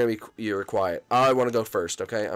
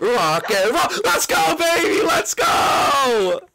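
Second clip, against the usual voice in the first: much higher, loud whooping cheer; victory shout; 4 dB louder, slower than usual. Real, not acted. Celebrating winning the round with a loud high cheer. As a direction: hype it up like you just won.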